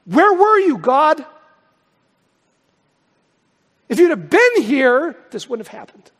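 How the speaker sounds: high, loud demand of God; anguished outcry. Acted. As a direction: cry out accusingly to heaven. Voices believer's accusing cry to God.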